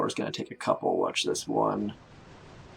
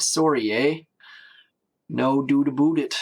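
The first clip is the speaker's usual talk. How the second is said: hammed-up Canadian accent, pitched up; thick Canadian accent; 3 semitones higher, 4 dB louder than usual. Streamer mocking own thick Canadian accent, exaggerated Canadian. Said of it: "my thick Canadian accent".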